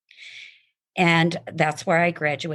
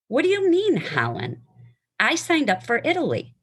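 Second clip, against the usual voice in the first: high incredulous outburst; stunned traveler, much higher on the question; surprised protest; 8 semitones higher than usual. Acted. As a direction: protest the wrong destination in disbelief.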